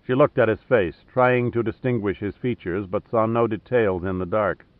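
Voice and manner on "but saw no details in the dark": ordinary delivery, nothing marked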